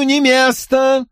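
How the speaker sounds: high, slow, stressing не; angry. A narrator's reading. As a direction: repeat it with stubborn force. Paul repeats his refusal.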